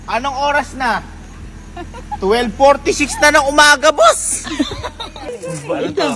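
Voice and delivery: high, loud mock outrage; mock scolding. Son to mother back late from shopping, mock scolding. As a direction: playfully raise your voice as if scolding.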